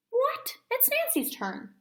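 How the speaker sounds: very high, outraged yelp. Acted. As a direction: squeal it in protest. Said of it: "yelped Bean".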